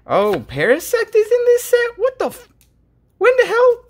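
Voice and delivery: high shocked outburst; surprised. Real, not acted. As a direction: blurt it out in disbelief. Surprised by an old Pokemon.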